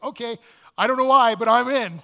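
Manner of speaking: loud, high, thrilled yes; excited agreement. Retold or imitated. Peter to the boat guy, loudly thrilled to hop in. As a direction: jump at the adventure without knowing why. Narrator eagerly agrees to board.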